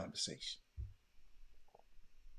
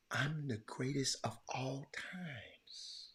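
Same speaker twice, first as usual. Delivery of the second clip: slow hushed reverent whisper; Muhammad Ali quote; 4 semitones higher, 8 dB quieter, much slower than usual. Retold or imitated. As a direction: breathe the line like a legend's motto. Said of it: "None of y'all remember Muhammad Ali".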